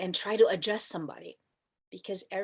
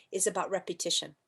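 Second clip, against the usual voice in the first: ordinary delivery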